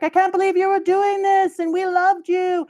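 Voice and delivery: high, loud outraged cry; betrayed crowd. Retold or imitated. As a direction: shout your hurt at the king.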